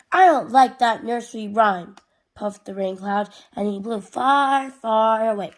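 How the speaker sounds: lively, projected picture-book reading; children's story narration. A narrator's reading. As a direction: read it animatedly to little kids. Reads storybook aloud to children.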